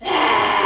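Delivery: big loud roaring exhale; lion roar. Shown as a demonstration. Teacher leads lion's breath for kids, a loud roar. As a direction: let out a full lion's roar. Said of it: "roar like a lion".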